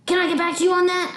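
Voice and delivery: high-pitched evasive reply; squirming kid. Acted. Child pinned by grandma trying to squirm away, high-voiced. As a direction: dodge the question while wriggling free.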